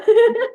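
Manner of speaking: a short high, loud laugh. Real, not acted. Laughing to the host after telling how amazed she still is. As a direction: let out a quick bright burst.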